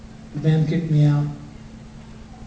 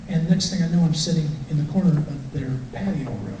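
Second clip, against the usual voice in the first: ordinary talk, nothing marked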